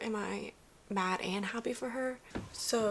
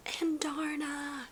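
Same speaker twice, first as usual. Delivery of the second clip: awed whisper; 6 semitones higher, 4 dB quieter, much slower than usual. Real, not acted. Shocked joy at a returning character.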